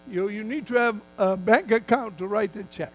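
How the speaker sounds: normal talking voice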